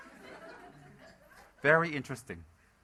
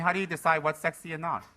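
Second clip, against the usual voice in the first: ordinary talk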